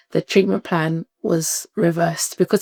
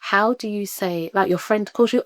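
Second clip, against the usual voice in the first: usual speaking voice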